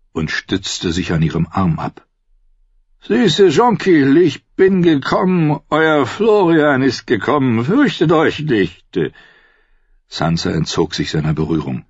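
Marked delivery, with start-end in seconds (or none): 3.0-9.0 put-on high, slow slurring drunk old knight; drunken fool. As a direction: play the tipsy gallant, drawn out. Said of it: "betrunken unter den Bäumen hervorgewankt". (hohe, langsame, betrunkene Narrenstimme)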